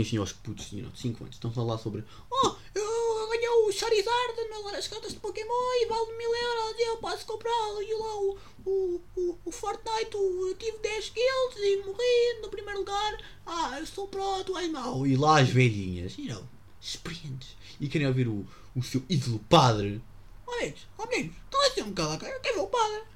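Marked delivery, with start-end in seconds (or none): 2.3-15.1 put-on very high whiny little kid; squeaky kid bragging; bratty kid voice. Kids voiced by old ladies. 20.5-23.0 put-on very high fussy old lady; old church lady. Church old lady scolding kids.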